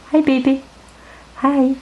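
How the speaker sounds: high, tender greeting to a cat; a lifted sweet hello for the cat. Real, not acted.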